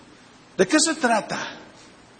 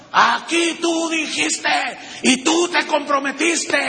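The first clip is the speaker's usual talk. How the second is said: a shouted plea that reminds God of his promise; demanding prayer; 6 semitones higher, slower than usual. Pretend. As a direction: hold God to his word at full voice. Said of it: "dígale a Dios".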